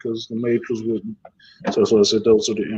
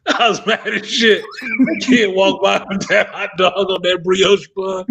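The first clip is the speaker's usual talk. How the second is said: laughing through it; 6 semitones higher, 3 dB louder, slower than usual. Real, not acted. Laughing while telling story.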